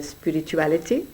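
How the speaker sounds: ordinary talk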